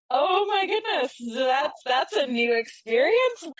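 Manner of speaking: high-pitched, drawn-out astonishment; retold wide-eyed amazement; shocked amazement. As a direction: act out being amazed, starting way up high. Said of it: "shocked and amazed".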